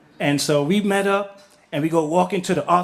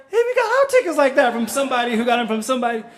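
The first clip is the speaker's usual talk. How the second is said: high cheery gossip; 5 semitones higher, faster than usual. Retold or imitated. Next guy adds same story.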